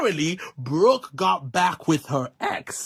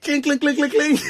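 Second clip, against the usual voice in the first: mock glass clink; 7 semitones higher, 3 dB louder than usual. [name] tapping a glass for attention.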